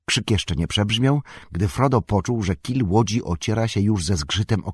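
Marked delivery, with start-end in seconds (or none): none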